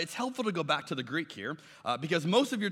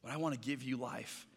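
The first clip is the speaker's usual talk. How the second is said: mild weeping plea; 5 semitones lower, 11 dB quieter than usual. Retold or imitated. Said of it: "Jesus is sitting there weeping".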